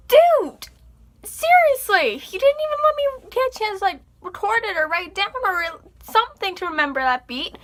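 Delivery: hammed-up fury at a friend; mock outrage. Yelling at friend who interrupted.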